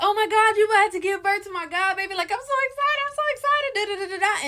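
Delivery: squealing high with excitement; excited friend. Retold or imitated. Friend bursts into the hospital room, squealing high with joy. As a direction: gush like a thrilled best friend. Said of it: "She's so excited".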